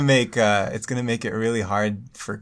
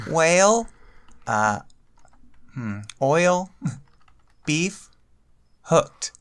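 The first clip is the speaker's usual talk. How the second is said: word by word; 6 semitones higher, slower than usual. Shown as a demonstration. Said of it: "type it out word by word".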